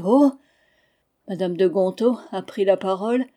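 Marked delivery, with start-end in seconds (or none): 0.1-0.3 put-on a short, loud cry of wonder from the two children; cried out oh. Children amazed by the Niagara story, loud cry. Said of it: "ont crié".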